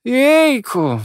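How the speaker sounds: high, loud weary groan; exasperated sigh. A narrator's reading. Avery sighing at Jack, high and exasperated. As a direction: sigh in disbelief. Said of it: "westchnął Avery".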